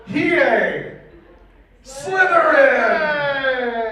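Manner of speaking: a hammed-up sorting hat roaring the house; drawn-out hat call. Reading fanfic, the hat calls out the house in a long drawn cry. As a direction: stretch out Slytherin like a proud announcer.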